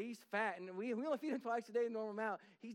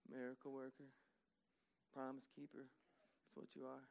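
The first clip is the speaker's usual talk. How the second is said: bored worshipper; 9 semitones lower, 14 dB quieter than usual. Retold or imitated. Pastor mocks listless worshippers, soft bored mumble. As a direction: drone the song half-asleep, barely audible.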